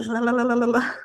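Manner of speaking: mock horse lips blowing out; lip-flutter imitation. Shown as a demonstration. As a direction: flap the lips like a horse's big exhale. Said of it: "their lips go blah".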